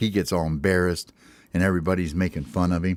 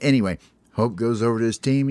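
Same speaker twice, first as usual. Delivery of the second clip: usual speaking voice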